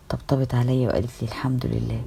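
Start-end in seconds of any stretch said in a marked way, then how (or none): 1.3-2.0 soft breathy whisper; hushed comforting whisper. Acted. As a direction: whisper it close while patting her back. Sister hugs her, soft reply.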